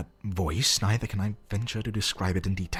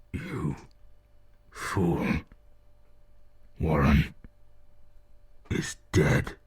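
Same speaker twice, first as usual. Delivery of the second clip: lower, hollow, unearthly; deep hollow voice; 3 semitones lower, much slower than usual. Acted. The thing answering Carter from the tomb, hollow unearthly voice. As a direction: intone it from the grave. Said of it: "the voice was deep, hollow".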